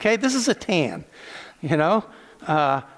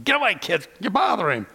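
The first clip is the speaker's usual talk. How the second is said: lightly acted gruff brush-off, pitched up; shooing disciples; 3 semitones higher than usual. Disciples push children away from Jesus, mildly acted shooing. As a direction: shoo the children off impatiently.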